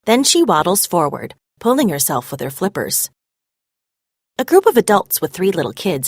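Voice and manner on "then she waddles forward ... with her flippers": usual speaking voice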